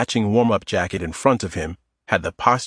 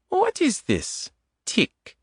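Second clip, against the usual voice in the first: put-on higher woman's voice with a light Eastern European accent; 4 dB quieter, slower than usual. Adita teasing Tom in the grocery aisle, Eastern European accent. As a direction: tease him in a soft Eastern European accent. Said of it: "In a light Eastern European accent".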